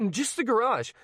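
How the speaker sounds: pressing, composure slipping; teen pleading, calm starting to crack. A narrator's reading. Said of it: "his calm starting to crack".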